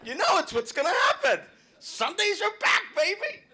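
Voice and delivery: loud, high game-day announcement; hyped shout. Real, not acted. Comic hypes the crowd for football Sunday. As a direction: pump the room up for Sunday.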